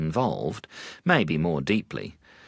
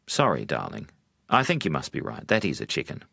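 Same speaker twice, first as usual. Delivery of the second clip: lower, gentle husband giving in; gently; 4 semitones lower than usual. Acted. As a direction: soften and apologise tenderly. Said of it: "he said gently".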